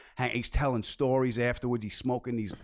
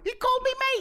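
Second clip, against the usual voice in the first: very high giddy squeal; flipped out; 6 dB louder than usual. Retold or imitated. Squeak it out in delight. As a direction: fan gushing after a phone call, high squeal. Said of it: "I flipped out".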